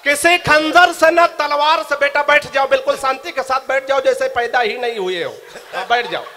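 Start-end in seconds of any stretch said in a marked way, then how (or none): 0.0-1.9 slow, louder declaiming; poetic recitation. Shown as a demonstration. Poet begins his couplet for the crowd, slow and declaiming. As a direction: start the couplet slowly, declaiming. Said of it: "सुनिएगा".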